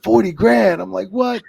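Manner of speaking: high, loud incredulous echo; retold disbelief. Referee hearing the boys' royalty figures, incredulous. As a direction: act out shock at someone's payout. Acted shock at colleague's royalty check.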